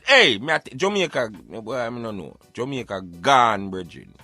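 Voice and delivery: ordinary talk, nothing marked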